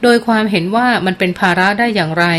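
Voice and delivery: usual speaking voice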